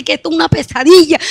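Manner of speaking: ordinary delivery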